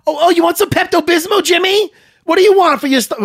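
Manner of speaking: high, loud fake caring voice; hammed-up worried producer, up high; mock concern. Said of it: "and you ask him".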